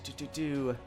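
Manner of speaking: ordinary talk, nothing marked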